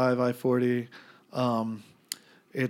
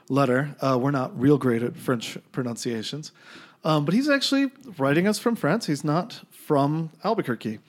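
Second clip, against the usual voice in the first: ordinary talk, nothing marked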